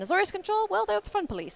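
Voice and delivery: high, bouncy teasing tone; playful teasing. Real, not acted. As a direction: tease the deck with a grin. Teasing the control deck.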